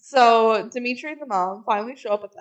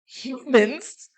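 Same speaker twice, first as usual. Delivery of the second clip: mock vampire scenting prey; lightly voiced vampire, questioning; Dimitri; 3 semitones higher, 4 dB louder than usual. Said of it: "he sniffs the air and goes".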